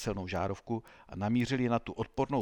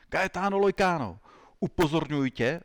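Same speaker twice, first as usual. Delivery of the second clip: raised, louder menacing warning; 6 semitones higher, 5 dB louder than usual. A narrator's reading. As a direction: warn him sternly, voice lifted. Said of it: "řekl výhružně".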